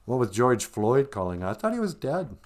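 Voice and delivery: normal talking voice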